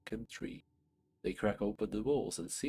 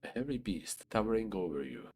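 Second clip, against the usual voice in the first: ordinary talk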